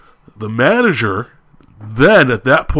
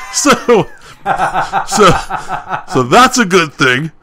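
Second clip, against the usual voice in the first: laughing; 7 semitones higher than usual. Real, not acted. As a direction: crack up at your own punchline. Laughing at the funny clincher.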